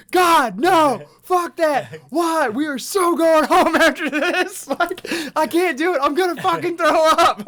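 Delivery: high, loud hammed-up shrieking; retold terrified screaming. Telling friend what the laughing staff heard, acted-out screams. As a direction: re-enact frantic screaming, wailing through the protests.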